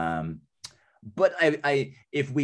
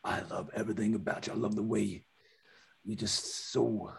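tender; 6 dB quieter than usual. Acted. Soft romantic wooing, slow.

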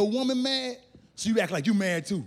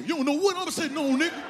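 high-pitched mock bragging; hammed-up high swagger; cocky boast; 6 semitones higher than usual. Shown as a demonstration. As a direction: brag defensively with the voice pushed up. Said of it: "In case they ask you".

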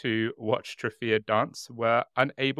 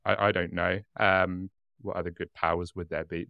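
usual speaking voice

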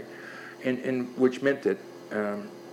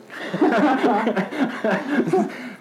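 loud high laugh; 12 semitones higher, 7 dB louder than usual. Real, not acted. Laughing at own war story.

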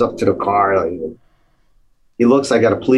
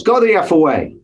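higher-pitched imitated brush-off; mock dismissive yell; 7 semitones higher than usual. Rock star to a knock at the door, mock irritated call. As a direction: imitate someone sending a knocker away.

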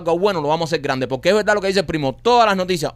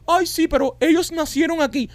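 high whiny mock voice; mocking the critics; 13 semitones higher than usual. Mimicking critics who say the owners were born here. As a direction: sneer it like a petty complainer.

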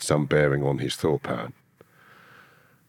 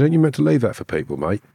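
high, fast, louder challenge to the cellmate; indignant challenge; 7 semitones higher, 3 dB louder than usual. Retold or imitated. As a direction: call him out sharply, pitched up.

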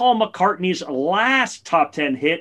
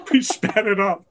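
laughing; 5 semitones higher than usual. Real, not acted. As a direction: repeat it through laughter. Laughing while repeating [name].

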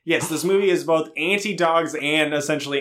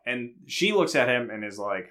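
normal talking voice

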